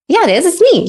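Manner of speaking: insisting. Retold or imitated. Arguing with grandma over photo.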